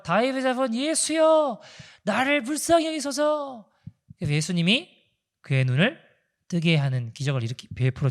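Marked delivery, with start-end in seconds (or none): 0.0-3.7 pitched much higher, slow desperate cry; crying out louder. Retold or imitated. Blind man crying over the hushing crowd, higher slow desperate plea. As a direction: strain the plea up high, begging harder. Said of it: "더 크게 소리를 질러요".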